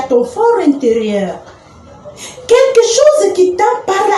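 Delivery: little inner voice. Acted. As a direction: speak as the small voice from the belly, unhurried. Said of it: "c'est ma voix du ventre qui me parlait, ma petite voix".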